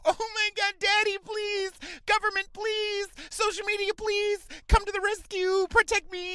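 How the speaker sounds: very high, loud, held-pitch wail; mock damsel pleading. Scene: comic mimics a helpless woman begging for rescue, mock pleading. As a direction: cry for rescue in a hammed-up high whine. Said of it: "you're not gonna go".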